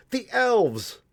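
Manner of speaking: loud, high excited shout; child's shout. A narrator's reading. Child spotting the elves, loud high shout. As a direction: shout it out with delight. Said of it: "shouted one of the children".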